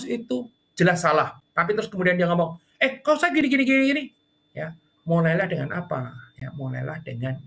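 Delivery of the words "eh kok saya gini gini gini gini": high and louder, indignant protest; wrong customer protesting, mock indignation. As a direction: complain hard even though you're wrong. Customer who is wrong complains loudly.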